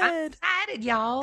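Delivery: high, squealing Savannah drawl, excited; Savannah accent. Retold or imitated. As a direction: imitate a giddy Savannah woman, high up. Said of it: "the Savannah accent is very specific".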